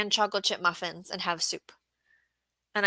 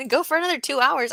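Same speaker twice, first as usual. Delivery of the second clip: laughing, higher and louder; 6 semitones higher, 7 dB louder, faster than usual. Real, not acted. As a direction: laugh it off at the joke. Laughing at chat request.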